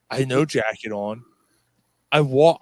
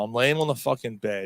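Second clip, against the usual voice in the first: ordinary talk, nothing marked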